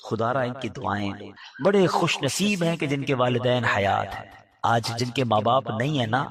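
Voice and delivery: ordinary talk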